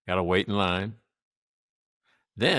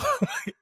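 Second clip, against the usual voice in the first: high burst of laughter. Real, not acted. Laughs at being hung up on.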